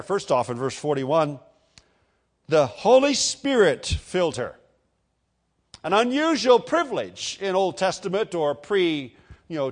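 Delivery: usual speaking voice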